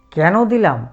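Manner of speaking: ordinary delivery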